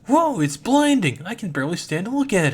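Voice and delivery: stunned high yell; awestruck shout. Acted. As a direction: shout at a dazzling light. Dazzled by golden oversoul.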